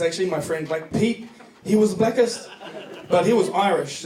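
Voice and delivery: ordinary talk, nothing marked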